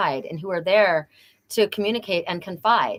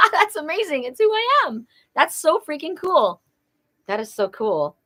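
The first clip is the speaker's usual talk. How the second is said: much higher, giddy delight; excited; 8 semitones higher than usual. Real, not acted. Gushing to the sitter about her name, very high giddy delight. Thrilled at being called Pegasus.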